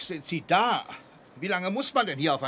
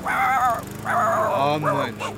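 excited; 5 semitones higher, 6 dB louder, much slower than usual. Acted. Herr ruft entzückt Hund.

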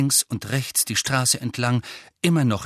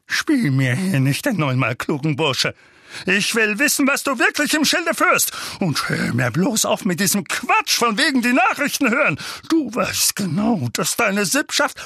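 high, strained angry tirade; furious accusing rant; ranting uncle; 10 semitones higher, 4 dB louder than usual. A narrator's reading. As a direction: tear into the boy angrily. Uncle rants at nephew.

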